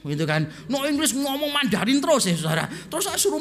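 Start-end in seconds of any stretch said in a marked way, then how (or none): 0.7-1.2 high-pitched, curt refusal, imitated; old Chinese driver. Old man from China refusing English, imitated. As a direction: mimic an old man's high, stubborn refusal. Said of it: "Orang tua dari Tiongkok itu".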